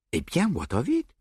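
a hint of Belgian detective; French accent. A narrator's reading. Poirot voiced.

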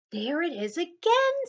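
high, loud discovery; there it is, eager; excited. A narrator's reading. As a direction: Gilbert spotting the sound. Gilbert hears sound.

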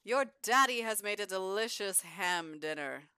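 high, loud announcing voice calling the family to dinner; loud cheerful dinner call; voicing the dad sim. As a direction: bellow it out like a proud dad at dinnertime. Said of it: "He's like".

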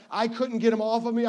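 normal talking voice

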